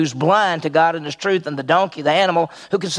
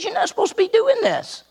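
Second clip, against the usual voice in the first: angel scolding. Acted. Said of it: "he says".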